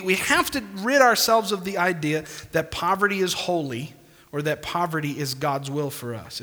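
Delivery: normal talking voice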